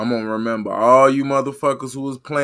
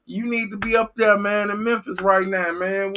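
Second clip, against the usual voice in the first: mock fan urging; 7 semitones higher than usual. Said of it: "Y'all supposed to be like".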